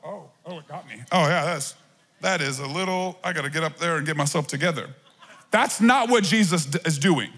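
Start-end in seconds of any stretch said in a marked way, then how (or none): 0.0-4.9 lightly voiced, hushed worshipper caught by emotion; softer, lower, mock overwhelmed; overcome worshipper. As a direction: mimic someone moved to a little worship tear. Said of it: "all of a sudden they're".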